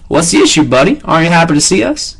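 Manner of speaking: a hint of a mocking Southern drawl; deep southern drawl. Guard teases Walter, light Southern drawl. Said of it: "he asked in a deep southern drawl".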